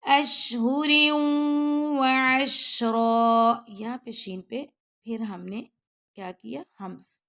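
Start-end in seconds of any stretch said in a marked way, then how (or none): 0.0-3.5 slow, louder melodic tajweed chant; slow chanted recitation; Quran recitation. Shown as a demonstration. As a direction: draw each word out in full recitation. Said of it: "teacher recites Quran verse".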